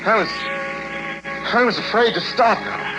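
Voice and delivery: sudden high, frightened catch; afraid. Acted. Driver haunted on the road, frightened. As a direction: voice jumps up in fear. Fearful narration of pursuit.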